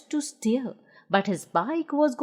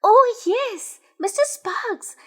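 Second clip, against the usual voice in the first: bursting with excitement; Noddy thrilled about the motor; 6 semitones higher, slower than usual. A narrator's reading.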